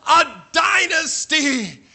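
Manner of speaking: high, loud hammed-up fan prophecy; mock rallying cry. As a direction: proclaim it like a mock rally, stretched and building to a shout. Mock rally for his football team.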